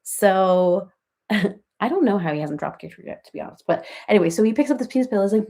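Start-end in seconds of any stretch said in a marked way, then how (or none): none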